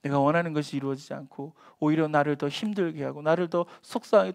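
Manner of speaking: normal talking voice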